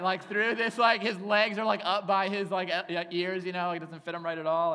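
high, loud giggly storytelling; excited build. Real, not acted. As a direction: paint the ridiculous image with glee. Excited comic image build.

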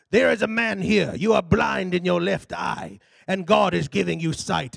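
imitating an African preacher's commanding call; African pastor. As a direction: declare it in an imitated African accent. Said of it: "Big old African guy".

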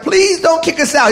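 high, begging demon voice; comic acted begging; pleading demon. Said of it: "And the demon's like".

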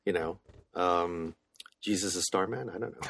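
mumbled deadpan. Real, not acted. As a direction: mutter it off under your breath. Deadpan joke, trailing off.

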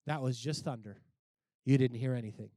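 low-pitched mock brush-off; dismissive onlooker. Voicing the crowd's dismissive response.

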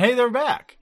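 high-pitched put-on excited call; excited announcement. Host echoing the character spotting them, mock excited call. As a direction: squeal the news in mock excitement.

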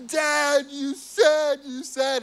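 put-on high, tearful child whimper; sobbing child. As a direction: plead through tears like a small boy. Sobbing son repeats the promise.

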